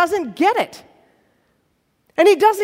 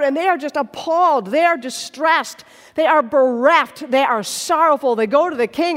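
ordinary talk

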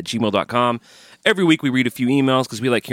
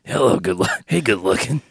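a put-on Dave come-on line; a hammed-up Dave-style greeting; Dave hello voice. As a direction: croon it like a cheesy Dave flirting at bedtime. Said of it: "the Dave hello voice".

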